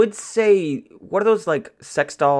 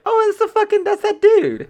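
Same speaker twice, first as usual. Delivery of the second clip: very high, louder mock yelp; mock recognition; 4 dB louder than usual. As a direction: squeal it like a fan spotting him. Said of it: "people are like".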